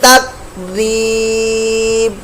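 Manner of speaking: very slow tajweed chant; slow Quran chant; Quran recitation. Shown as a demonstration. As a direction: stretch every syllable. Teacher recites the verse.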